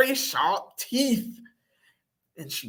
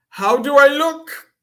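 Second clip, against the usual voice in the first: loud, higher-pitched preening; showing off; 7 semitones higher, 10 dB louder than usual. A narrator's reading. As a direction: strike a pose with your voice, loudly. Mister Dog strikes a pose.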